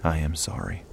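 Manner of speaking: soft, trembling hush; softly comforting a grieving friend; quivering whisper. Acted. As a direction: barely voice it, moved. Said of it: "I whispered, my voice quivering".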